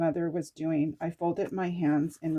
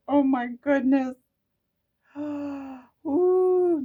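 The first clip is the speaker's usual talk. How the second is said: gasping awe; 8 semitones higher, 8 dB louder, much slower than usual. Real, not acted. Overwhelmed by vision.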